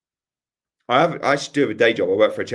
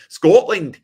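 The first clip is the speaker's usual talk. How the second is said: high, loud Scottish burst; Scottish accent; 9 semitones higher, 10 dB louder than usual. Shown as a demonstration. Streamer taking a viewer's request, loud Scottish accent. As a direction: exclaim it in a put-on Scottish accent. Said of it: "do Scotland".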